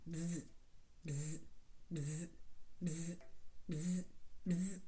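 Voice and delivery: soft low buzz on each beat; bzz, bzz, steady bee buzzes. Shown as a demonstration. Teacher showing children how to buzz on each beat, soft. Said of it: "buzz like a bee".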